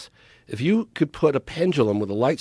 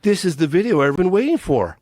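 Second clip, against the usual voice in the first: higher and louder, bursting with excitement; thrilled exclamation; 7 semitones higher, 4 dB louder than usual. Retold or imitated. Filmmaker telling Chuck Clark the tape is unbelievable, excited. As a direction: sell how long you've waited for this. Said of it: "I said".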